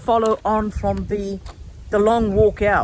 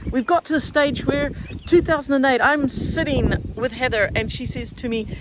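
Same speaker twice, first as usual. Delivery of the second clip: ordinary talk, nothing marked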